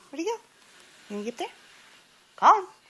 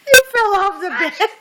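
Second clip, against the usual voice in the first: laughing; 9 semitones higher, 9 dB louder than usual. Real, not acted. Laughing at the fallen bird.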